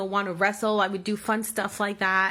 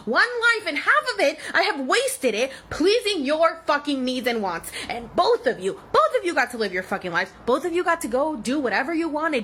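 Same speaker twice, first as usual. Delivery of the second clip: loud, high furious shouting at parents; angry rant; 5 semitones higher, 3 dB louder than usual. Real, not acted. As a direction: hammer every line with rage.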